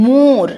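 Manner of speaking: মোর with the stress on a long ও; English word pronunciation. Shown as a demonstration. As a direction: press on the ও in মোর. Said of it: "মোর মানে ও এর ওপর চাপ পড়ছে".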